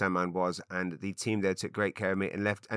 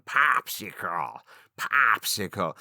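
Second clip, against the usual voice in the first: "popsicle" in a mocking American accent, drawn out; exaggerated American "pahp-sicle"; 3 dB louder, much slower than usual. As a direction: make "popsicle" sound gross and American.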